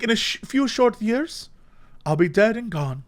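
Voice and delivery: lightly voiced elderly British philosopher pondering existence; old British man. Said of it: "the voice I did ... an old British man".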